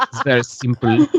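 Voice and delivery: a touch of mock German accent with a laugh in it; silly German accent. Shown as a demonstration. Voice actor joking how easy it is, silly German accent. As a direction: keep up the joke German accent lightly. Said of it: "like a silly German accent".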